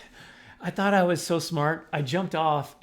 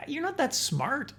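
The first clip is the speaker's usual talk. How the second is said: dog's voice; 8 semitones higher than usual. Retold or imitated. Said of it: "Chico was like".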